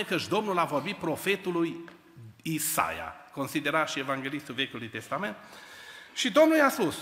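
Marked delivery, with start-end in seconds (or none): 6.1-7.0 raised preaching voice. Real, not acted. Preacher builds to God's call.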